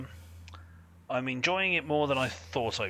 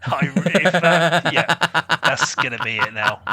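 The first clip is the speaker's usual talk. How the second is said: loud laughing through words; cracking up, can barely get it out; loud giggly delivery; 5 semitones higher, 10 dB louder, slower than usual. Real, not acted. Laughing fit after joke.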